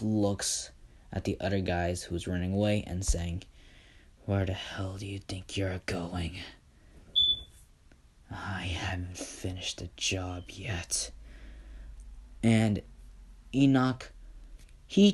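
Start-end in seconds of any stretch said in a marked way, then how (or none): 4.2-11.1 cold whispered verdict on the humans. Acted. Izuku stops the fleeing men.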